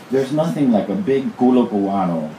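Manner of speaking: lightly acted complaint, a little lifted; mock-serious family complaint; complaining. A narrator's reading. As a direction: grumble it as a proud opinion. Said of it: "he complained".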